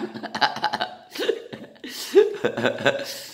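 unvoiced wheezing laugh; breathy laugh. Real, not acted. Laughing at the fallen filling.

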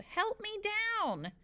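high, drawn-out call for help; yell. A narrator's reading. Stuck boy yells to Calvin from the tree, yelling high. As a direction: stretch the plea out high. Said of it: "I yelled".

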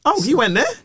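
high-pitched mock astonishment; lightly acted impressed surprise; impressed crowd. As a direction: react like an amazed audience. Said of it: "everyone was like".

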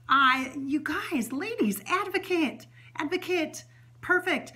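rally the ladies to advocate; excited urging. Real, not acted.

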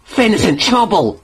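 high, urgent exclamation; alarmed cry. A narrator's reading. James realises his friend is in danger, crying out. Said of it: "he cried".

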